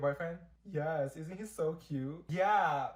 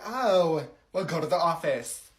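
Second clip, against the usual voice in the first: a hint of an annoyed teacher, louder on the oh; snippy teacher; 6 dB louder, slower than usual. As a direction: toss out the order like a fed-up teacher. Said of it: "And she said".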